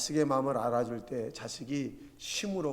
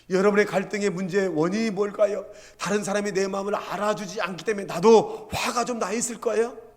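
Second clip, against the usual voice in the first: loud, raised-pitch sermon delivery; forceful preaching; 6 semitones higher, 10 dB louder than usual. Real, not acted. Preacher to congregation about family conflict, forceful and loud. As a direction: drive the question home to the congregation.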